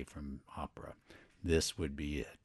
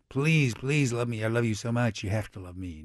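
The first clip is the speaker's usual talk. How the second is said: mock pleading lover; 7 semitones higher, 6 dB louder than usual. Don Jose begging in retelling.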